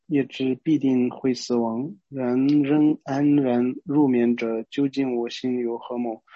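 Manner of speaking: slow, measured reading of scripture; verse recitation. Acted. Teacher reading a verse aloud before explaining it, slow recitation. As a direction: recite the verse slowly, line by line.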